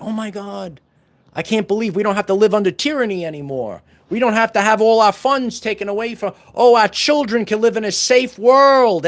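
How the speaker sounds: high, relieved and excited; dawning joy. Retold or imitated. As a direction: burst out in disbelieving joy. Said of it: "there's something".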